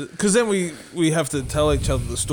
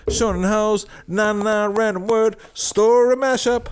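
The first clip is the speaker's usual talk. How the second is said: announcer voice; 5 semitones higher, slower than usual. Acted. Announcing the segment title.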